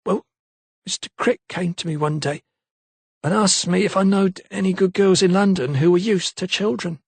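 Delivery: a lightly voiced London maid, unsure; maid's voice. A narrator's reading. Maid Eliza's voice.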